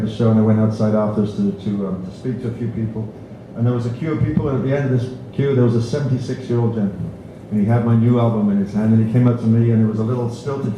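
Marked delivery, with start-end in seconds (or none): none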